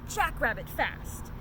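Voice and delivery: usual speaking voice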